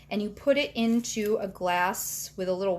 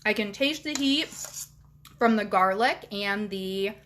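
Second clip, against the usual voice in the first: chewing while talking; speaking through a mouthful of noodles; mouth full. Real, not acted. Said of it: "talking with my mouth full".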